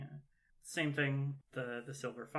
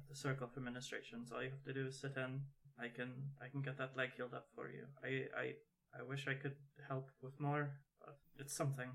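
soft offer; 8 dB quieter, faster than usual. Acted. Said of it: "she's gonna come up and".

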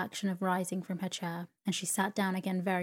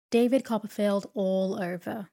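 sharp exclamation, a little louder; crying out; 5 dB louder than usual. A narrator's reading. Aunt exclaiming over her late nephew's ways, mild cry. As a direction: cry it out in exasperation, mildly. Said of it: "cried Miss Betsy".

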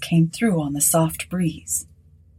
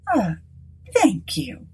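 dry, higher woman's thanks; 5 semitones higher, slower than usual. Acted. Mrs Sinclair answering Aylett's routine visit, dry. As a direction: say it dryly, unimpressed. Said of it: "she said dryly".

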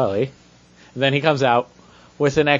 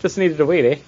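mock Canadian; 7 semitones higher than usual. Acted Canadian character quote.

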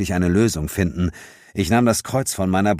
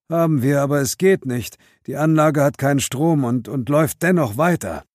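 helpless policeman voice; 8 semitones higher than usual. Acted.

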